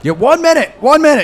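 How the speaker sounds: high, loud harried smith; shopkeeper voice. Acted. GM voices busy shopkeeper offstage.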